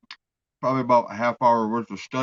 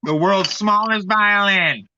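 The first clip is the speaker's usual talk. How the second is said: slow, louder world's smallest violin line; mock sympathy; 7 semitones higher, 7 dB louder, slower than usual. Teasing his friend with mock sympathy, slow and loud. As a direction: play the tiny sad violin at him.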